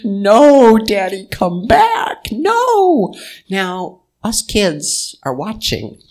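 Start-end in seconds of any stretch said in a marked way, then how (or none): 0.0-3.1 high, loud wailing cry of "No, Daddy"; retold scream. Aunt at the casket crying out to her dead father, loud wailing. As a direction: wail it like a grieving daughter at the casket.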